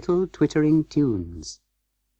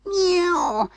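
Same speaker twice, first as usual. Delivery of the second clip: very high, drawn-out meow; cat's mew. A narrator's reading. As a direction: mew like a shut-out cat. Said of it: "scuffled Simpkin".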